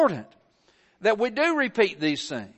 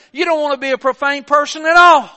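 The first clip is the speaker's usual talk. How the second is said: louder, higher pulpit cry; raised sermon voice; preaching shout; 5 semitones higher, 9 dB louder than usual. Real, not acted. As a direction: hammer home "at all". Preacher builds to a climax.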